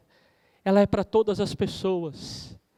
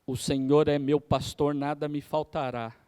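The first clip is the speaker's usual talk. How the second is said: "O Senhor é meu pastor" read lower with weight on "Senhor", falling on "nada me faltará"; lower, solemn reading leaning on "Senhor", fading on "faltará"; intonation demo; 5 semitones lower than usual. Shown as a demonstration. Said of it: "Cada entonação dessa, eu estou dando uma forma".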